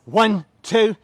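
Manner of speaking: loud, high count-off over the music; energetic coach calling reps; shouted counts. Real, not acted. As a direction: bark the reps out to the class.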